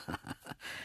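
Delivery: very soft, breath-only snicker; sly chuckle. A narrator's reading. Narrator pleased with a sneaky plan, chuckling slyly. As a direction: snicker quietly to yourself.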